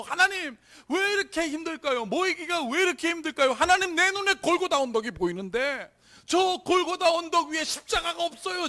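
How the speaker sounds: high, loud lamenting prayer; re-enacted crying out. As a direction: shout your complaint up to God, re-enacting it. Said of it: "막 탄식하면서 막 그냥 소리 지르면서".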